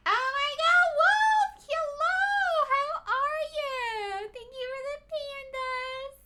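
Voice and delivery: high, loud squealing greeting; excited squeal. Real, not acted. Streamer greeting raider Wolf, thrilled high squeal. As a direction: squeal with delight at a friend.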